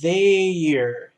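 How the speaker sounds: teacher revealing their as the answer; stressed answer word. Shown as a demonstration. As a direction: land the answer their with weight. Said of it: "É".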